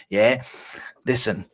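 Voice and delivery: ordinary delivery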